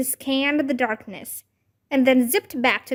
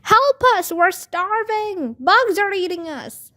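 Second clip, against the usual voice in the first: high-pitched, hammed-up distress call; hollering; 7 semitones higher, 4 dB louder than usual. A narrator's reading. Girl in attic to babysitter, hollering. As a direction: holler melodramatically to be rescued. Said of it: "hollered Bean".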